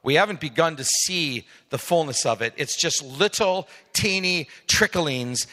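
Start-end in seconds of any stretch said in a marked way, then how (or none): none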